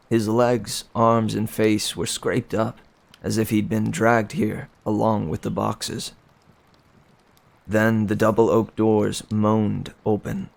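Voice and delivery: plain reading voice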